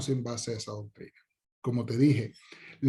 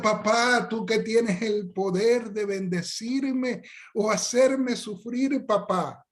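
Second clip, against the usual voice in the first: much higher, slow, syrupy mock plea; pleading child; 8 semitones higher, slower than usual. Child keeps flattering father for an ice cream, high slow plea. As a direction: stretch every word like a child begging.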